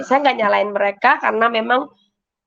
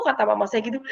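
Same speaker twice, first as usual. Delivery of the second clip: ordinary delivery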